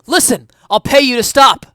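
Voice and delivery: high, loud shouted offer; yelling. Acted. Detective yells a bribe at the running suspect, loud and high. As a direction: yell a bribe at a runner. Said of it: "I yelled".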